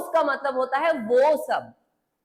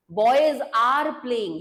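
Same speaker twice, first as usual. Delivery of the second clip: very slow demo; much slower than usual. Shown as a demonstration. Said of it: "अगर मैं बोलूं कि".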